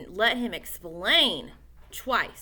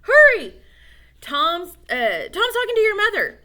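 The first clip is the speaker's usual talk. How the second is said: much higher and louder, hurried; urgent yell; 9 semitones higher, 10 dB louder than usual. Acted. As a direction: shout a warning up. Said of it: "yelled Pete".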